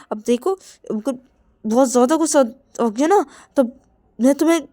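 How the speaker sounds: loud, high, heated warning; Rahul building anger. Acted. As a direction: tell him your anger keeps rising. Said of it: "Rahul बोलते कि".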